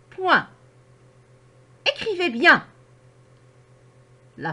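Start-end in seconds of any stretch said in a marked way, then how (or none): 1.9-2.6 "Écrivez bien" pitched higher with a fall at the end; a bright order to the class, high then dropping; command tone. Shown as a demonstration. Teacher showing pupils an order, high firm command. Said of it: "Le ton est haut, puis il descend. La voix peut être plus forte".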